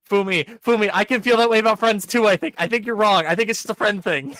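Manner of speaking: loud, high protest; high-pitched, loud pleading with a character; mock outburst. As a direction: burst out in exasperated denial, playfully overdone. Mock outburst answering the nervous heroine.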